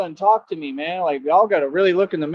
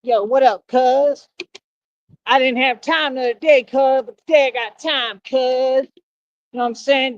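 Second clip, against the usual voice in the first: higher, hammed-up slang persona; exaggerated street talk; 5 semitones higher than usual. Streamer mocking commenters in a hammed-up street slang voice. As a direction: ham it up as a swaggering street dude. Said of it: "Imagine if I talk like that every day".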